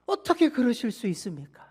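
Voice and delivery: loud, indignant complaint to his father; elder son, furious at the feast, confronts his father; angry protest. Acted. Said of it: "아버지에게 이렇게 따집니다".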